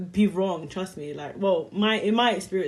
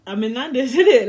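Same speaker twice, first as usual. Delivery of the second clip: louder, laughing cheeky reply; cheeky retort; 5 semitones higher, 10 dB louder than usual. Retold or imitated. Telling the late date she's already in Nando's, cheeky. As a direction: throw it back at him with a laugh. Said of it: "I'm like".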